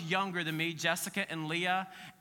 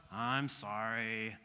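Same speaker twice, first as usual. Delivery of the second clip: put-on a kid forced to apologize to sisters, sulky and low; sulky apology; 7 semitones lower, 8 dB quieter than usual. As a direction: mumble it like an eye-rolling kid. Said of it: "world-class eye roller".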